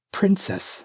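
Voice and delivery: frightened servant; timid maid. Acted. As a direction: answer with fear. Maid answers fearfully.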